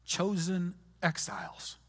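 ordinary talk

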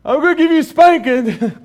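high, loud mock-stern threat; mock stern dad. Dad mock-threatening his two-year-old, high and loud. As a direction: playfully threaten the toddler. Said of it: "I'm like".

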